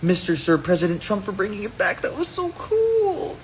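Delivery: high, sugary imitation of grateful fans; climbing into a squeaky fawning voice; gushing mock fan. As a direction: gush in a mocking high voice, drawing out the end. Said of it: "they're like".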